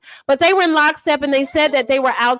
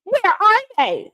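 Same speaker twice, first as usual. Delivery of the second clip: high, slow demanding where; demanding quote; 9 semitones higher than usual. Retold or imitated. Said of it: "She was like".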